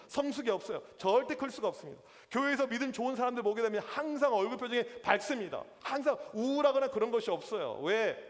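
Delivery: usual speaking voice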